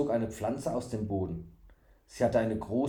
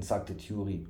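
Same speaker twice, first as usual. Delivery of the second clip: ordinary delivery, nothing marked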